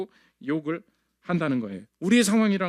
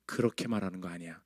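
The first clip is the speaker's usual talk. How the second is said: low, much softer voice of God correcting him; gently but firmly rebuke, dropped low; God rebuking; 10 semitones lower, 10 dB quieter than usual. Acted. God correcting Jeremiah's complaint, low and soft. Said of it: "하나님이 짚으십니다".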